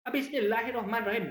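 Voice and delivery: high, emphatic Madurese-accented Bismillah. Shown as a demonstration. Host shows guys what is not allowed, Madurese accent. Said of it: "Nggak bisa pakai logat Mad".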